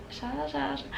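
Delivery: tiny hushed imitation of a chant; timid echo. Fan echoing idol's name game, timid and hushed. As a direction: echo it very softly, barely voiced.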